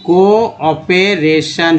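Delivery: Cooperation very slowly, co-o-pe-ra-tion; syllable by syllable. Shown as a demonstration. Teacher joining CO to the rest, cooperation in slow chunks. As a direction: stretch every part of cooperation. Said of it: "सीओ को".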